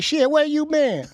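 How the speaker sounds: high-pitched mock grandma yell; mock grandma shout. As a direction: squeal it like grandma scolding.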